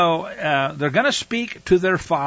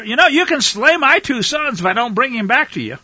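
pleading outburst; 7 semitones higher, 5 dB louder than usual. Acted. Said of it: "He says to his father".

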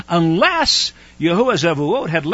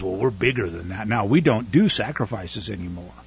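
mock smug excuse; 10 semitones lower, 8 dB quieter than usual. Mocking the churchgoers' excuse.

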